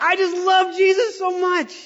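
gushing. Retold or imitated. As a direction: belt it out like you can't contain it. Gushing made-up song about Jesus.

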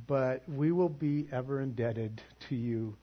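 ordinary talk, nothing marked

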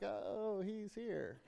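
the disappointed version; unimpressed. Pretend. Congregation reacting to the guest preacher, mock letdown. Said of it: "that whole storyline".

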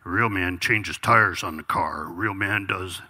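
low, mock tough-guy thought; a hint of gruff manly scoffing; lightly voiced macho man. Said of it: "and I'd think".

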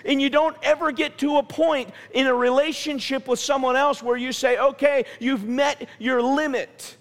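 high, emphatic pulpit delivery; forceful preaching. Real, not acted. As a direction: drive each point home to the congregation. Preaches the point at full force.

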